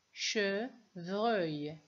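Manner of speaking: "Chevreuil" in two beats, che then vreuil; syllable by syllable. Shown as a demonstration. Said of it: "Deux syllabes". (« Chevreuil » en deux temps : che, vreuil)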